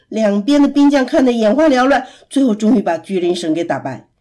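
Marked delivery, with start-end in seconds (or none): none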